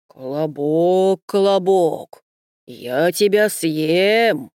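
slow and heavy like a big bear. Acted. The bear threatens.

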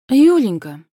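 affected, drawn-out coo; mincing drawl. A narrator's reading. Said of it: "жеманно протянула жена начальника".

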